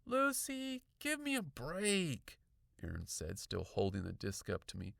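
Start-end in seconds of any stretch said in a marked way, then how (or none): 0.0-2.4 put-on high, drawn-out kid's voice; dismissive boy. A narrator's reading. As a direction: brush her off, exasperated. Boy brushing her off.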